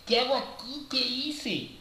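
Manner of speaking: high, pleading cry of despair, lightly acted; a worker retelling his first-day tears, mildly tearful and high; retold crying plea. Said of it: "yo lloraba".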